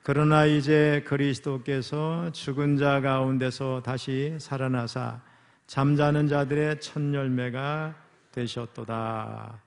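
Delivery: slow, loud scripture reading with stress on 그러나; congregational reading. Shown as a demonstration. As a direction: read it aloud slowly with the congregation, stressing 그러나. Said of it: "우리 이십 절 같이 읽습니다. 시작".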